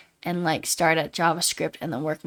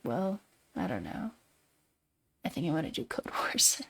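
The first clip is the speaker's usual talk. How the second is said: whispered; 4 semitones lower, 6 dB quieter than usual. Retold or imitated. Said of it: "And I was like".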